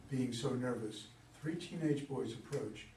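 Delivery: ordinary delivery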